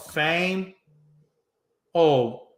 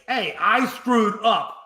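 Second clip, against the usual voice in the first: higher, slow, sing-song admission; slow, higher mock Pete Rose; slow mock confession; 5 semitones higher, slower than usual. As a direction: drag it out like a rehearsed apology. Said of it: "and states".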